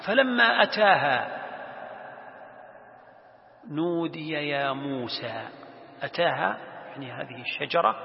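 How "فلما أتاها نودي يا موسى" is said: slow chant-like verse reading; Quran recitation. Acted. Teacher quoting God's words about Moses, reciting slowly. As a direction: recite slowly, holding the long vowels. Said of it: "قال الله سبحانه وتعالى".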